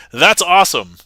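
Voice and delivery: loud, high excited cheer. Retold or imitated. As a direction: shout it out excitedly. Reading chat message aloud.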